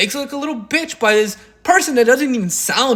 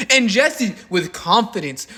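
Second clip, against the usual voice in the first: normal talking voice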